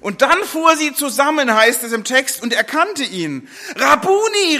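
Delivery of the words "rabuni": Rabuni cried out high and loud; joyful cry. Acted. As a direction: burst out with Rabuni in sudden recognition. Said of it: "rief sie".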